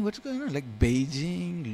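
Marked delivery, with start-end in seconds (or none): 0.0-0.5 much higher, baffled question; a bewildered high exclamation; puzzled surprise. Retold or imitated. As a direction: sound thrown by the street names. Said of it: "And I'm going".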